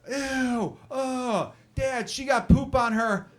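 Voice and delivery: put-on high-pitched kid voice, drawn out; high, slow childish dismay; grossed-out little boy. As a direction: imitate a disgusted five-year-old asking dad. Voicing his grossed-out five-year-old son.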